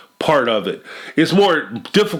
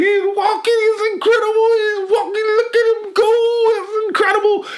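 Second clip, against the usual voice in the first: very high, hammed-up squeal of delight; exaggerated high-pitched gushing; mock gushing parent. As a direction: squeal like a parent seeing first steps. Mocking excited new parents.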